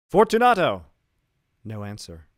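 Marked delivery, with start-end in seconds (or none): 0.1-0.8 loud, high call into the dark; calling aloud. Acted. Montresor calling Fortunato through the wall. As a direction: shout his name into the niche. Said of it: "I called aloud".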